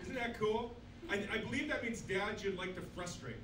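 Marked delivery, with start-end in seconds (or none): none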